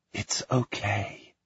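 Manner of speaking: slow, soft whisper. Acted. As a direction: soothe her in a whisper. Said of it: "her sister whispers".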